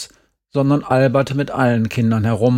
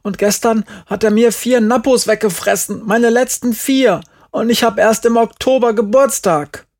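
put-on high, loud childish grievance; aggrieved boy protesting loudly; indignant shout; 10 semitones higher, 5 dB louder than usual. A narrator's reading. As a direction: pile up the accusations, voice pitched up. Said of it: "rief Markus".

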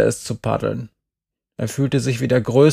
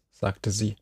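ordinary delivery, nothing marked

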